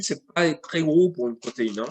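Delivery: ordinary talk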